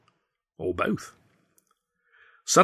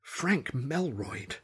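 very slow, soft disbelieving question; incredulous; 6 semitones higher, 7 dB quieter, much slower than usual. Acted. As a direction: turn the name over in disbelief. Narrator's slow incredulous musing.

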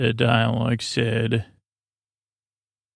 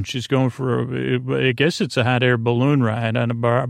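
usual speaking voice

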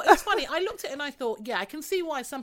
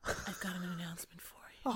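whispered, softer announcement; 3 semitones lower, 11 dB quieter than usual. Retold or imitated. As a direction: breathe it like a secret. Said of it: "she doesn't even talk like that".

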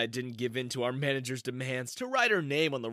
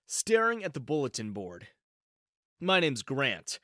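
plain reading voice

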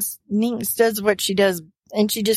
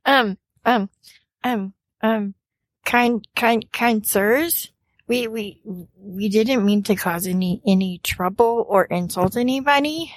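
nervous, stammering apology; hesitant, halting plea; flustered fumbling. Acted. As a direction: trip over repeated words while trying to placate. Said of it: "she's fumbling over her words".

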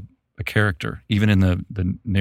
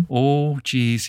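put-on Midwestern accent; 5 semitones higher than usual. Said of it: "that kind of".

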